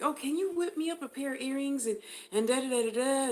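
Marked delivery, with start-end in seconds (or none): 0.0-1.9 eager customer. Retold or imitated. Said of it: "somebody will be like".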